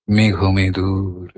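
মেঘ মেদুর low, slow and evenly drawn out; soft, unstressed glide. Shown as a demonstration. Reciter showing the feeling of the word, soft unstressed glide on মেঘ মেদুর. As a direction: let মেঘ মেদুর glide slowly in a low register, no stress. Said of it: "মিড়টা বেশ স্পষ্ট হয়ে উঠল".

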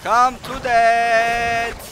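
each word stretched very slowly; very slow taunt. Acted. Gamer luring the boss, very slow drawn-out taunt. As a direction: draw out every syllable to mock.

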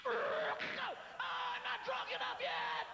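put-on slow, drawn-out drunk yell; mock drunk shout. Guy heading home yelling for Happy Hour, drunk. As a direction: bellow it like a bar regular. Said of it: "some guys walk home and they".

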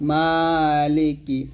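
মালিকি with long maa, short li and ki; stretched maa. Shown as a demonstration. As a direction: draw out only the first vowel of মালিকি. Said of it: "কি টান দেওয়া যাবে না".

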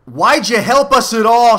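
high, loud, indignant shout; angry shout. A narrator's reading. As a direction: shout it out in anger, pitched high. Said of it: "shouted Milo angrily".

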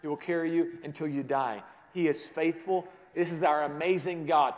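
normal talking voice

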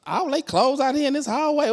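high-pitched mock gloating; arrogant gloating. Husband spotting clothes in hallway, arrogant gloating. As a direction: crow over someone else's trouble. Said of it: "I'm so arrogant, I'm thinking".

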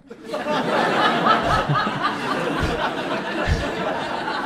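a real high laugh at the memory; laughing at his own prank; high breathy laugh. Real, not acted. Comic laughing at his own prank, real laugh.

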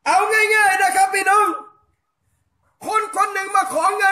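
fervent shouted sermon, hammering each question; preaching at full force. Acted. Preacher rousing the island congregation, fervent shouting sermon.

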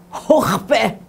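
"hochpe" barked like a cough, high and loud; coughing word. Shown as a demonstration. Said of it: "You're coughing".

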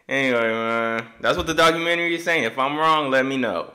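normal talking voice

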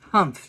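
loud indignant humph; huffy humph. Acted. Vashti seeing her framed dot, loud huffy humph. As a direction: scoff like a miffed child. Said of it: "she thought".

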